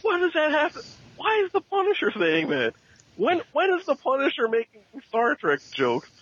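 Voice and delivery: high-pitched disbelief, baffled; can't believe what he's reading; incredulous. Real, not acted. Baffled outrage at comic dialogue.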